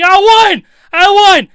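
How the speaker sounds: high, full-volume victory shout; re-enacted jubilant holler; triumphant yell. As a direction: scream it out, bursting with the secret. Bursting with a secret win.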